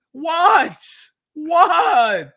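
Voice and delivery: loud, high "What?" of disbelief; exasperated outburst. Real, not acted. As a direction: shout it out in frustrated disbelief. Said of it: "I'm getting so angry".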